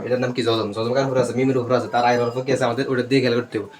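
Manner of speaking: ordinary delivery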